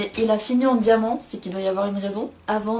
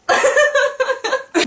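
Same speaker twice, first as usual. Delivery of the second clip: rire très aigu et fort; éclater de rire sans retenue; grand éclat de rire; 8 dB louder than usual. Real, not acted. Rire sur son résumé raté. (fou rire aigu et sonore)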